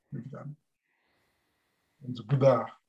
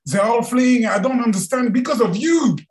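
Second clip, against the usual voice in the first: higher, louder frustrated cry; Mara's outburst; 11 semitones higher, 6 dB louder than usual. Acted. Mara blames the Buddha, loud and frustrated. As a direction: vent it all at him.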